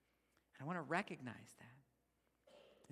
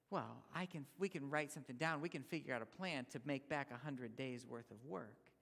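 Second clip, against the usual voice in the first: ordinary talk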